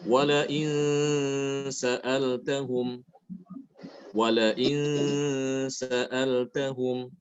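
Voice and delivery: slow level recitation, long nasal on "in"; ikhfa before sin. Shown as a demonstration. Teacher demonstrates the letter sin, slow held nasal. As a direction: hold the hum in "in" before "sa'altahum". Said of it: "ketemu dengan huruf sin ya".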